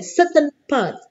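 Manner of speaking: slow, pointed stress on "certain parts"; emphasis on certain parts. Shown as a demonstration. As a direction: stretch out "certain parts" so it gets noticed. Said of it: "Perhatikan ya".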